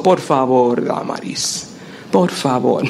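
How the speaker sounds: a patient husband calming her down, low. Retold or imitated. Husband calming his anxious wife, low and patient. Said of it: "mi esposo".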